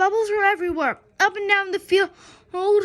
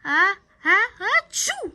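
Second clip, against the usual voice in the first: building higher into a sneeze; sneezing cheer; 4 semitones higher, 5 dB louder than usual. Acted. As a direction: chant that breaks into a sneeze. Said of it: "said the cheerleaders".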